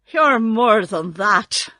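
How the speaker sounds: slow, loud reproach; grieved. A narrator's reading. Scarecrow reproaching the false wizard, grieved. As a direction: reproach him slowly with a touch of hurt. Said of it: "in a grieved tone".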